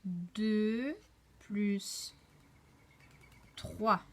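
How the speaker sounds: deux, plus, trois, each loud with long pauses; slow counting aloud. Shown as a demonstration. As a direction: present the sum clearly, word by word. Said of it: "regarde bien". (calcul énoncé lentement, mot par mot)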